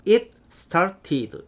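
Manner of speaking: "It started" sounded out word by word, slowly, with full t's; diligent Korean-style reading. Shown as a demonstration. Teacher showing how Koreans dutifully read it, slow spelled-out Korean-accented English. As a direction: read "It started" slowly with every letter pronounced. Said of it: "굉장히 성실하게 저 문장을".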